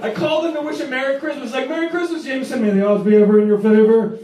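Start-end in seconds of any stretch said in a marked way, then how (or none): 1.7-4.2 booming grand proclamation ending loud; mock Hunger Games announcer. Big sister to teen brother on the phone, booming mock ceremony. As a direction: intone it like a Capitol host.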